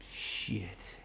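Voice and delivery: muttered curse under the breath; very soft, almost whispered oath; dismayed thought. Retold or imitated. Man to himself, caught by his neighbour, dismayed.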